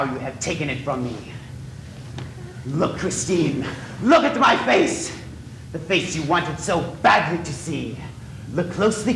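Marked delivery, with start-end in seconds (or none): 4.0-5.2 furious command. Acted. Eric forces Christine to look.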